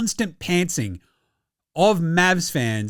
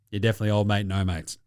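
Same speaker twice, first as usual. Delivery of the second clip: much softer, lower sign-off; quiet mock tag; 9 semitones lower, 5 dB quieter, much faster than usual. Real, not acted. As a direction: let it drop to a mutter. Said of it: "quiet sign-off of the segment".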